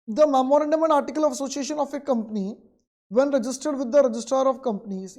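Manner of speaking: reads the textbook line out very slowly, word by word; slow dictation. Acted. Teacher dictating the textbook definition to students, very slow. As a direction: dictate it so students can write.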